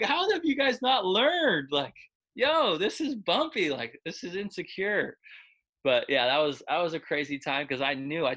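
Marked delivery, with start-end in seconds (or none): 0.0-5.2 slow, high, louder indignant scolding; indignant complaint. Retold or imitated. Complaining to paramedics.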